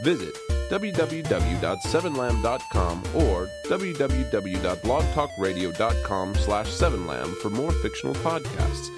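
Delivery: normal talking voice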